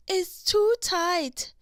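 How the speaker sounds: high, slow strained cry of effort; pinched little cry, stretched out slowly; cried out. Acted. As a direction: let it come out tight and pained. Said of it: "she cried".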